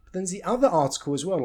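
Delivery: ordinary talk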